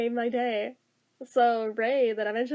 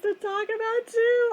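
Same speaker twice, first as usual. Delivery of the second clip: re-enacted rising glee, high and drawn out; giddy; 10 semitones higher than usual. Guessing which coworker he meant, giddy. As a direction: gush it, pitch climbing then settling. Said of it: "just made me really giddy".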